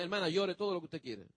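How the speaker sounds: mild reassuring hush; gentle pastoral comfort. Retold or imitated. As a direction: soothe a weeping church sister. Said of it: "le digo a la hermana".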